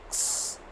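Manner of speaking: क्स breathed out unvoiced and soft; whispered ks. Shown as a demonstration. Teacher showing kids the X sound as a soft ks. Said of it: "एक्स की sound आएगी क्स".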